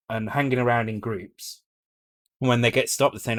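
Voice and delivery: normal talking voice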